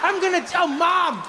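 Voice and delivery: high, mock-wailing child's reproach; tearful accusing kid. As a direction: imitate an outraged little boy crying at dad. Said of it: "They're both in tears".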